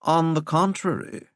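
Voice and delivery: high, affected English drone; droning English accent. A narrator's reading. Handler contradicting the president, high English drone. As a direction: correct them airily in an English accent. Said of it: "droned in that ridiculous English accent".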